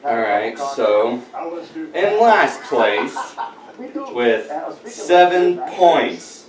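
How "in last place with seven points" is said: slow drawn-out reveal; suspense reveal. Acted. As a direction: stretch out the results. Building suspense in a results reveal.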